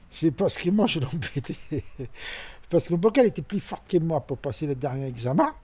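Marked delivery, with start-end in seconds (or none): 1.6-2.5 quiet airy chuckle; a soft guilty little laugh; soft breathy laugh. Real, not acted. Father laughing at his old mischief, soft chuckle.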